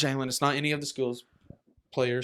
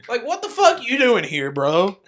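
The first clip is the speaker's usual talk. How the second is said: laughing mock heckle; 6 semitones higher, 3 dB louder than usual. Mocking fans heckling the outsider.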